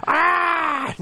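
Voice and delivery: high crow-like dragon screech. Pretend. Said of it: "that's my dragon voice".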